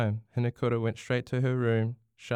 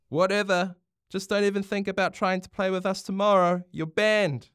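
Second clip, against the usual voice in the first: higher, petulant shout; angry yell; 9 semitones higher, 4 dB louder than usual. A narrator's reading. Bronx bans Hinakura as she leaves, yelling. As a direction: yell it after someone walking off. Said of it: "Bronx yelled after her".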